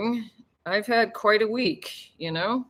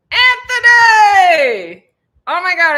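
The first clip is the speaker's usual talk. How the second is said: drawn-out high happy yell; welcoming a friend into the live stream with a long, high, happy shout; excited shout; 11 semitones higher, 13 dB louder, slower than usual. Real, not acted. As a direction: call his name out long and loud. Greeting a viewer who joined.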